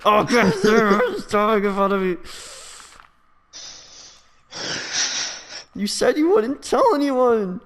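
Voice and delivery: high, loud whiny mock voice; mock whiny Jaden. Friend mocking Jaden listening on mic, in a high whiny voice. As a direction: whine like a teased kid caught out.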